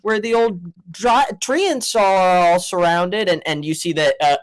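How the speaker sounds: ordinary talk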